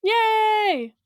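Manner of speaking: high, long-held cheer; playful stretched hooray. Pretend. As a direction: draw out a high little cheer. Cheers at trivia fact.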